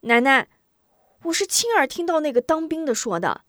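put-on eager boy. Boy hurries to assure grandmother, high eager voice. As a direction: answer hastily, insisting you heard it. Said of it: "吴忠忙答".

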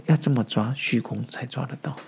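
ordinary delivery

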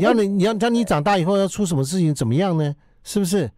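high, nagging parent voice; nagging mom lecture. Retold or imitated. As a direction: scold like a worried mother. (唠叨妈妈训话)

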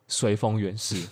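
normal talking voice

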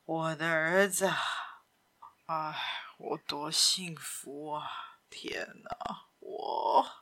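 hushed, low and half-whispered; low murmur. A narrator's reading. Simon pleading with his son, in a pained murmur. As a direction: talk softly to yourself, voice sunk low. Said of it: "而是低声地说着".

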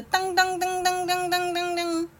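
high, sustained dang-dang-dang; clanging clock noise on one high note; ringing clock imitation. A narrator's reading. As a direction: chime on and on like a clock.

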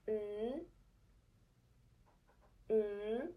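"Mm" hummed twice, each rising at the end; second tone. Shown as a demonstration. Teacher humming for learners to repeat, rising second tone. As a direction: hum each "Mm" upward, pausing between. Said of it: "second Chinese intonation sounds like this".

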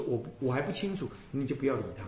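normal talking voice

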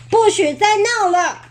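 高而稍响的严厉制止; 板起来喝止孩子; 不高兴. Acted. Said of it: "不高兴地说". (爸爸推门进来，不高兴地制止)

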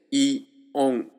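イ, then オン, each set apart and high; イ, pause, nasal オン; separate syllables. Shown as a demonstration. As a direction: model イ and オン as two isolated sounds. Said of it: "一緒に発音すると".

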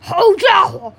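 loud gloating troll threat with a laugh. A narrator's reading. Troll gloats he will swallow the second goat, loudly.

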